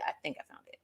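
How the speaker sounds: breathy near-silent aside to herself; unvoiced murmur to herself; whispered aside. Real, not acted. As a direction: mutter it under your breath while searching. Muttered to herself.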